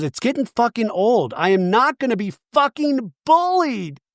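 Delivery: imitating a friend fed up and hurt; mock indignant. Angie protesting the bullying, mock angry. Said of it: "Angie goes".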